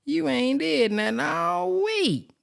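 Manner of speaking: high, slow scolding call-out; mock scolding. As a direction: drag it out high like a fed-up scold. Scolding someone for doing nothing.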